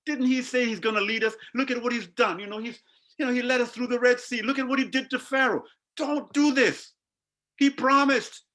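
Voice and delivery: from hushed begging rising to high urgent pleading; begging plea. Retold or imitated. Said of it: "We can see them begging them".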